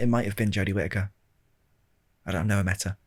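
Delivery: normal talking voice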